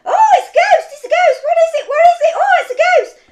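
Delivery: high, very loud screams of terror; panicked shouting. Acted. Disciples all shouting at a ghost from the boat, panicked screaming. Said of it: "all began to shout and scream".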